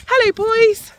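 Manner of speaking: high, bright hello to the horses; excited greeting. Real, not acted. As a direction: call out happily to the ponies.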